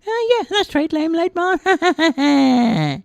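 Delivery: very high squeaky voice, slow on the name; chirpy defiant reply; cheeky tiny man. Acted. Tiny man answers the dark being, high and cheeky.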